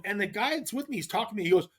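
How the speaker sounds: ordinary delivery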